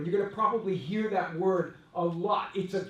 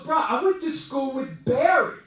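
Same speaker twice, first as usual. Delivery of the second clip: retold shout; 8 semitones higher, 7 dB louder than usual. Friend repeats boast loudly.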